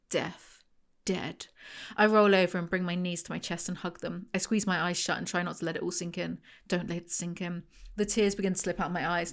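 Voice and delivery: quiet, low reading of a sad first-person story; reading aloud. A narrator's reading. Reading her book excerpt to viewers, quiet and low. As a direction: read the excerpt softly and steadily.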